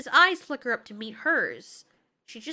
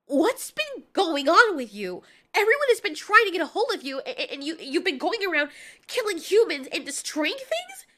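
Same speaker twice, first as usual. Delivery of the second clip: loud, higher-pitched scolding; agitated yelling; 5 semitones higher, 5 dB louder than usual. Acted. Mothra confronts Godzilla about killing humans, yelling. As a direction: yell it in stammering exasperation. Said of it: "Can you kindly stop yelling?".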